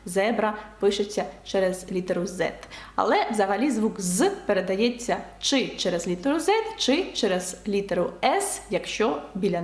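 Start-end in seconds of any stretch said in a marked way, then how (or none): none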